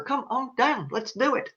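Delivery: a hint of the host's come-on-down; playful quiet imitation of the announcer; Bob Barker call. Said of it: "So like Bob Barker".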